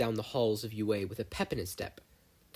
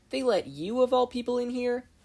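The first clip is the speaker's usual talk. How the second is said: much higher and louder, outraged; can't believe they let her in; indignant disbelief; 13 semitones higher, 6 dB louder than usual. Acted. Mei confronting her rival Mina, indignant.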